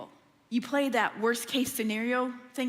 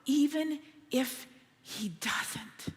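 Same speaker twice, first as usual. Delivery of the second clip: slow, unvoiced whisper; soft whisper; 3 dB quieter, much slower than usual. Shown as a demonstration. Pastor stressing the youths' faith, whispered. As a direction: breathe it gently. Repeated emphasis.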